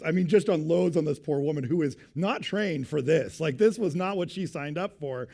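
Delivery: ordinary talk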